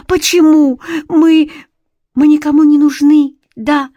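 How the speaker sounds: tearful shout. A narrator's reading. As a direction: cry out near tears, slowing at the end. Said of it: "мокрыми от слёз глазами". (отчаянный крик сквозь слёзы)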